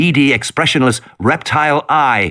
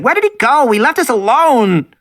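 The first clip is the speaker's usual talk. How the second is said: put-on frightened girl; 13 semitones higher than usual. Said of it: "Lex said".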